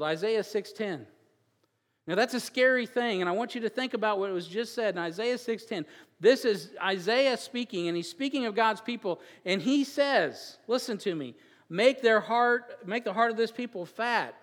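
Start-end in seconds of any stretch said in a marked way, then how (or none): 2.1-11.3 higher, louder preacher's build; full-force preaching. Acted. 11.7-14.3 slow, higher and louder on the first words, pressing the verse; preached verse. Acted. Preached second reading of verse.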